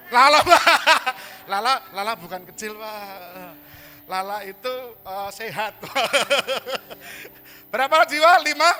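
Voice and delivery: laughing shout. Acted. Preacher jokes about a congregant and counts souls, laughing loudly. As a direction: shout through laughter at the crowd.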